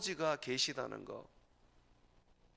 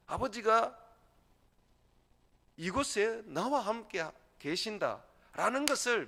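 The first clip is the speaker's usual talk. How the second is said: fervent preaching; 8 semitones higher, 5 dB louder than usual. Acted. Preaching at full force.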